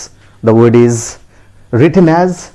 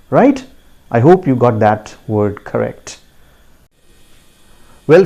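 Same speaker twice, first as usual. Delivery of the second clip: normal talking voice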